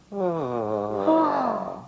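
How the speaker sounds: long sigh with high voice; loud voiced sigh. Shown as a demonstration. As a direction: let out a big sounding sigh. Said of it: "put sound with it".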